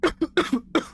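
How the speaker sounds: choking cough on water; hacking cough. Real, not acted. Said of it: "went down the wrong pipe".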